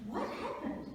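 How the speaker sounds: high, soft, puzzled question; startled mouse. Acted. Mouse thinks after the bang, soft, high and startled.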